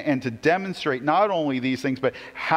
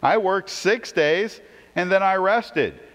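voicing God; 7 semitones higher, 4 dB louder than usual. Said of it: "And he says".

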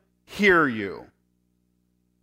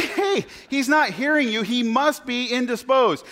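mocking Elijah; 7 semitones higher than usual. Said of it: "He's saying".

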